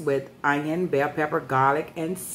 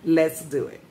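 ordinary delivery

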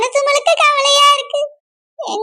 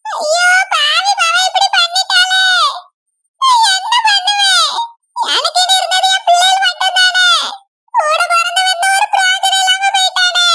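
crying; 5 semitones higher than usual. Acted. Mother lamenting her child's fall into the well, wailing. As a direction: wail like a mother in anguish. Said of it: "அக்கா, அழாதீங்கக்கா".

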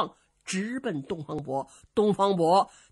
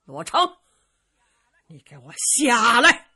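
loud shout; 3 semitones higher, 10 dB louder than usual. A narrator's reading. Dongfang Bo calling Luo Cheng down from the platform, loud shout. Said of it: "东方伯抬头高声喊嚷".

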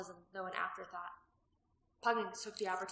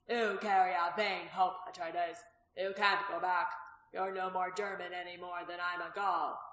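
louder, slow, mocking innkeeper voice; scoffing innkeeper; 6 dB louder than usual. Innkeeper mocking the gladiator's hope, loud and slow. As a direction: lean on each word, scornful and sure.

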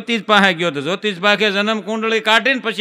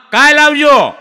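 sharp dismissal; 6 semitones higher, 8 dB louder than usual. Acted. Manager dismisses the drunk.

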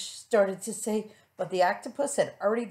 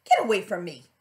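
angry rebuff; 8 dB louder, faster than usual. Acted. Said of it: "cried the Rainbow Fish".

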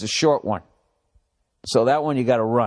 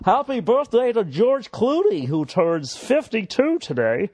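hammed-up announcer; 9 semitones higher than usual. Reading birthday from astrology site.

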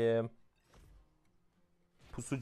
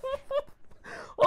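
high strained laugh close to a wail; a desperate laughing wail; cry-laugh. Real, not acted. Gamer breaks into a desperate laughing wail. After the outburst.

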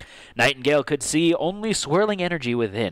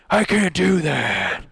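slow, drawn-out hero's protest; 5 semitones higher, 4 dB louder, slower than usual. Acted. Hero refusing to leave his friend, drawn-out protest. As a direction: protest like an earnest story hero.

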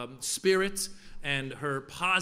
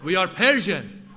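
put-on louder, lecturing in a Persian accent; proud dad, Persian accent; 3 semitones higher, 8 dB louder than usual. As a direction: preach pride to your son in a Persian accent. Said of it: "And my dad would say".

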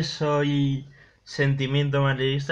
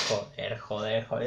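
muttered curses, much softer; cursing under breath; 6 semitones lower, 11 dB quieter than usual. Real, not acted. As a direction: swear quietly to yourself. Muttering after a missed chance.

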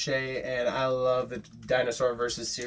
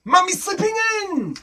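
mock-dramatic announcement; 5 dB louder than usual. A narrator's reading. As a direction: announce the challenge in a high cry.

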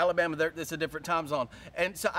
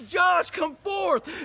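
slow, loud, high call; Jesus calling Josh out of the grave; 9 semitones higher, 5 dB louder, much slower than usual. Acted. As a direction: call him out of the tomb. Calling out by name.

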